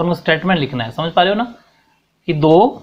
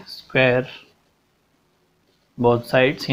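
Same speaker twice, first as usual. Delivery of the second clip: slower and softer, for copying down; slow, softer dictation repeat; 4 semitones lower, 5 dB quieter, much slower than usual. Shown as a demonstration. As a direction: repeat it slowly so they can write it.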